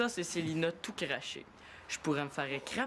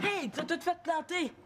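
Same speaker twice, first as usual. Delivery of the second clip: high, drawn-out angry protest; angry outburst; 9 semitones higher than usual. Acted. Shouting at whoever ruined the work, angry outburst. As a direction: accuse them, pitch jumping up.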